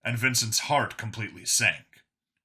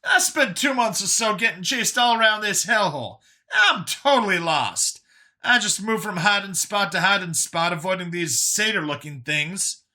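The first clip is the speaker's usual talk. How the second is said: high, animated complaint; high voice, venting about being lost; Brian, exasperated survivor; 8 semitones higher, 4 dB louder than usual. Acted. Brian admitting he's lost to Vincent, high and exasperated.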